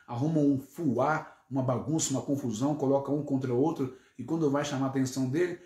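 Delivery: ordinary delivery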